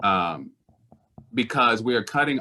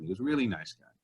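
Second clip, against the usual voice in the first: usual speaking voice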